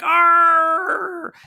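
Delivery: loud high mock growl of rage; frustrated roar. Clockman locked out, roaring in frustration.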